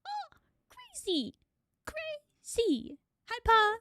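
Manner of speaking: squeaky newborn voice, drawn out; high baby voice. Retold or imitated. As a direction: voice a freshly born baby. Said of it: "I was like".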